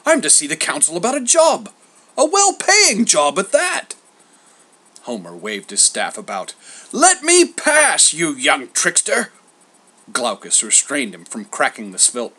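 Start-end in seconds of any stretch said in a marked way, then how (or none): 0.1-4.0 high, loud indignant bluster. A narrator's reading. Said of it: "he blustered". 6.9-9.3 slow, high, loud indignant demand; angry bluster. A narrator's reading. Cross old Homer waving staff.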